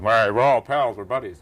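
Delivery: loud, carefree pals line; tossed off like friendship is a given; breezy buddy talk. Retold or imitated. His old self taking friends for granted, breezy loud.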